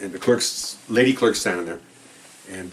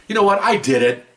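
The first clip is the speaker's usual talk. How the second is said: much higher, louder naive confession; mock confession; 11 semitones higher, 6 dB louder than usual. Imagined defendant confessing outright, mock naive. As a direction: play a guilty man owning up.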